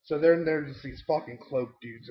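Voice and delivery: normal talking voice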